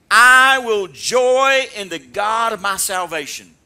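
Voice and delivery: loud, slow declaration; emphatic sermon quote; preached proclamation. Acted. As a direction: ring it out in triumph.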